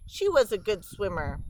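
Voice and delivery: ordinary delivery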